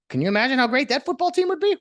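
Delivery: high, loud sarcastic cheer; hammed-up excitement; mock hype. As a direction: hype it up like a fake announcer. Sarcastic hype for equity team.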